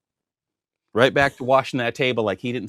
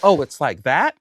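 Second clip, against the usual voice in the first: pointed, louder retold challenge; 5 dB louder, slower than usual. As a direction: throw it out louder, rising at the end.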